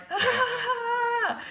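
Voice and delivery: high, sustained power-up yell; hammed-up anime battle scream; Super Saiyan scream. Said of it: "Super Saiyan".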